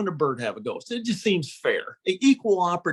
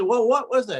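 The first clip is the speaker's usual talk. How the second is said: impatient dad; 8 semitones higher, 4 dB louder than usual. Retold or imitated.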